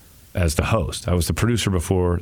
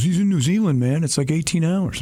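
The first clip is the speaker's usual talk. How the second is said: much higher excited voice; excited coworker; 10 semitones higher than usual. Retold or imitated. Coworker chiming in during the prank, excited. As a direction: blurt it out like a buddy. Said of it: "somebody goes".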